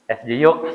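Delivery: "jiyuk" with y for r, through a laugh; laughing husband says "jiyuk"; mock mangled "jiyuk". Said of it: "pas minumnya agak bisa".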